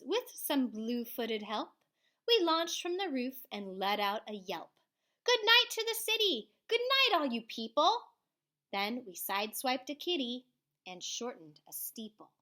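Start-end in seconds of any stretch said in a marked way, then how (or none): 5.2-8.0 high, loud, fast shout; shouting good night. Acted. Kids shouting good night over the city. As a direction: yell it gleefully to the city.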